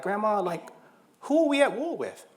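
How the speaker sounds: put-on high, wondering little-kid voice; child asking grandma. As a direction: ask it like a small boy, pitched well up. Child asking grandma about violence.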